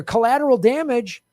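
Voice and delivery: normal talking voice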